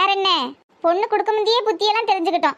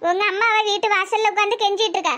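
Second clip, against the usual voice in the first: usual speaking voice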